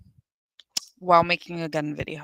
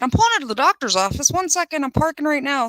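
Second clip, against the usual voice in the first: high, fast mock of the defendant; higher hurried imitation of a driver caught out; 7 semitones higher, faster than usual. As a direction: squeak it out like a flustered driver. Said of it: "My guy said".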